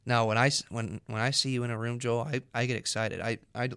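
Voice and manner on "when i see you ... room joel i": ordinary delivery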